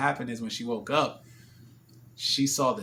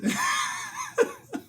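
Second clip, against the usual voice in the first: very high mock sobbing; hammed-up, high-pitched boo-hoo; imitated crying. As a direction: squeal out a child's embarrassed sobs. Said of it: "I'm embarrassed".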